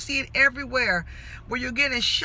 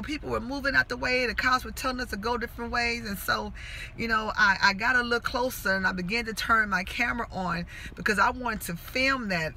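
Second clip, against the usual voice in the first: ordinary talk, nothing marked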